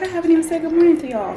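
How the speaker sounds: high, fast, sudden excitement; excited realisation. Retold or imitated. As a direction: blurt it out, high and quick. Quoting her own surprise.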